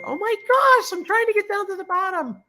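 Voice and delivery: overwhelmed, bursting with glee; squealing excitement. Real, not acted. Overwhelmed by chat gifts.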